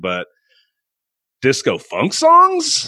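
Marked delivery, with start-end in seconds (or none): 1.4-2.9 incredulous. Real, not acted. Mocking Lloyd Webber's disco number.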